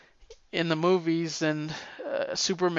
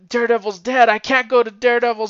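panicked; 6 semitones higher, 7 dB louder, faster than usual. Retold or imitated. Panicked Mary Jane seeking help.

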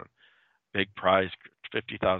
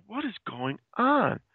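high, slow, a touch of mock fear; bewildered and scared, drawn out; frightened confusion; 13 semitones higher than usual. Tied to a gravestone at night.

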